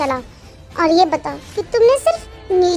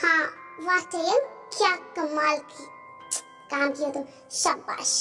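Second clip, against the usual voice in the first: much softer, private inner voice; hushed self-congratulating aside; talking to herself; 9 dB quieter than usual. Acted. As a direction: think it aloud under your breath. Character's scheming thoughts to herself.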